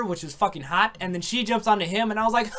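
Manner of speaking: usual speaking voice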